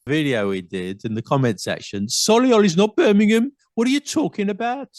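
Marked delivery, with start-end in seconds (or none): 2.1-4.9 high, sing-song Brummie complaint; amateur Birmingham accent, pitched high in protest. Acted. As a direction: put on a hammed-up Brummie lilt. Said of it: "I'm doing my Birmingham accent".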